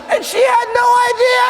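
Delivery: very high, loud near-crying squeal; shaking with laughter. Acted. Colleague finishing the reveal, hysterical laughing wail. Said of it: "he is shaking with laughter".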